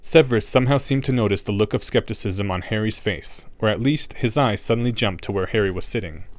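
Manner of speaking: ordinary delivery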